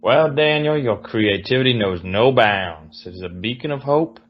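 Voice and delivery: slow, syrupy Southern genteel praise; hammed-up Southern accent, dark ending. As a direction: draw it out in a Southern drawl, slowly. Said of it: "The Southern genteel".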